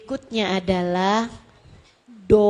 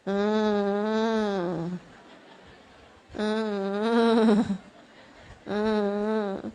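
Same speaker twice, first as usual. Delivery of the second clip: low droning fake whine; long, low, unconvincing whimper; mock child's whimper; 4 semitones lower, 3 dB quieter, much slower than usual. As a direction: imitate a child's half-hearted crying. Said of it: "nangisnya kayak gitu".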